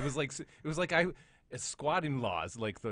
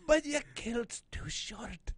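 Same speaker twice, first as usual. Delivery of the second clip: slow, very high Scottish punchline; hammed-up squeaky Scots; mock Scottish accent; 10 semitones higher, much slower than usual. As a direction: stretch the joke out in a high fake brogue. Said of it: "there goes my classic".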